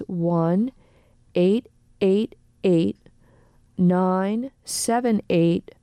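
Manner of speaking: phone number read out slowly in separated groups; slow, deliberate. Real, not acted. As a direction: say each digit slowly, pausing between groups. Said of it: "we're gonna say it slowly".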